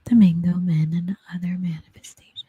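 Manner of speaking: whispered title, breathy and close; mock ASMR whisper of the title. Shown as a demonstration. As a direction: murmur the title like an ASMR read. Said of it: "Be like".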